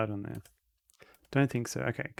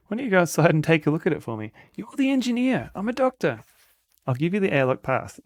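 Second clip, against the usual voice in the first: character voices; 6 semitones higher, 6 dB louder than usual. Acted. Voices game characters arguing.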